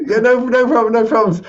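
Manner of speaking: high, loud, rushing to reassure; over-eager reassurance. Retold or imitated. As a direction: blurt it out high and loud, far too keen. Retold over-eager reassurance to buyer.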